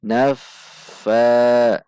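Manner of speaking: "Naffa" dengan f mengalir menyambung, lebih keras; tidak terpisah. Shown as a demonstration. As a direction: sambung f ganda "Naffa" tanpa putus. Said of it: "tidak terpisah". ("Naffa" berdesir f, fa panjang)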